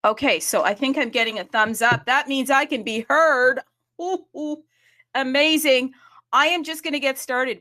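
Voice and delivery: loud, high-pitched excited announcement; excited relief. Real, not acted. Host to audience after getting a thumbs up, excited. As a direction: celebrate being heard, voice lifted and loud.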